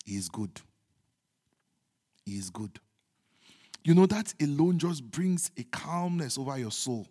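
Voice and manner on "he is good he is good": very soft, low murmur with long pauses; calm devotion. Real, not acted. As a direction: let it settle as a hushed reflection. Quiet meditation on the psalm.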